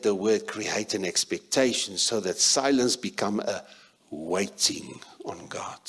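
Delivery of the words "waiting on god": softly whispered, slow; hushed whisper. Shown as a demonstration. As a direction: breathe it out in a hush. Preacher models silent waiting.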